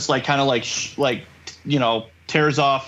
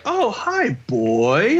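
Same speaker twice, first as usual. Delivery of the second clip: melodic; 4 semitones higher, much slower than usual. Retold or imitated. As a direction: let the pitch move a lot, lilting greeting. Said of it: "he's more melodic in his voice".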